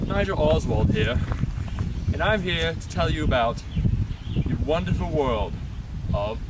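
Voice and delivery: loud, showy host introduction; TV presenter voice. Acted. Mock presenter opens the show.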